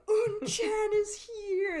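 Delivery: high, loud, drawn-out cheer; imitating wild excitement. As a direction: shriek the news like an overjoyed crowd. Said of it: "everyone just goes apeshit".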